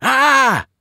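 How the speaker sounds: high loud cry of waking terror; sudden high shriek; startled scream. Acted. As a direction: jolt awake with a yell. Waking from a nightmare.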